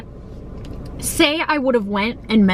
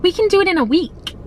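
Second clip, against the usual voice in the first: mocking landlord; 9 semitones higher than usual. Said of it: "she was like".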